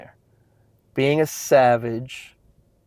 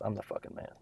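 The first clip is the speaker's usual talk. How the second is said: half-whispered, much softer, low-key claim; muttered answer; 4 semitones lower, 17 dB quieter, faster than usual. Retold or imitated. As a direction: say it almost under the breath. Instructor answers Bedros in exercise.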